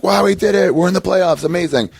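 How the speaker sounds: thrilled the team made the playoffs; excited. Retold or imitated. Fan celebrating his team reaching the playoffs, excited. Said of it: "the last win or two was like".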